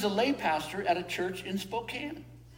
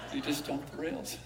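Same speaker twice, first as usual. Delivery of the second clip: laughing; 3 semitones lower, 6 dB quieter than usual. Real, not acted. Laughing at the hobo punchline.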